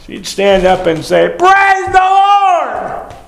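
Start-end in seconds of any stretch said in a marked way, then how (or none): 1.4-3.1 high-pitched drawn-out holler of praise; excited church matriarch. Retold or imitated. Old church aunt moved by a blessing, mock ecstatic holler. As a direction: wail it out slowly, way up high, hammed-up. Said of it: "When she'd get happy".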